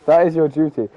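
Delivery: ordinary talk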